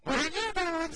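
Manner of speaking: slow, high sing-song "What are you doing?"; teasing cutesy voice for a toddler; playful baby-talk. Shown as a demonstration. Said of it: "pop your head in the room and go".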